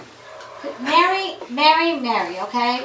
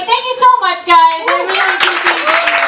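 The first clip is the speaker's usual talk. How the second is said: effusive thanks; 8 semitones higher, 5 dB louder than usual. Real, not acted. Closing thanks to audience.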